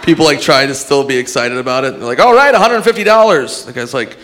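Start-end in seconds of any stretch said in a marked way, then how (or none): 2.2-3.6 mock host. Host announcing small prize.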